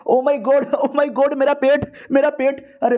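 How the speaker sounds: overacting pain to dodge the job; faked stomach ache. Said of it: "बहाना बना लेता हूँ". (नाटकीय ढंग से झूठा पेट दर्द)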